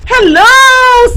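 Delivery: high, loud delighted shout; excited hello. A narrator's reading. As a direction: greet the stranger eagerly. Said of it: "said Dazzle".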